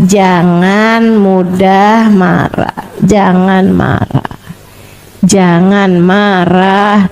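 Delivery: low, very slow, soothing repetition; gentle repeated advice. Retold or imitated. Prophet gently repeats his advice, low and very slow. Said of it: "memberi padanya nasihat".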